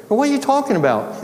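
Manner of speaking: high-pitched incredulous question; baffled protest. Retold or imitated. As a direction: demand an explanation in disbelief. Baffled teacher reacts to student.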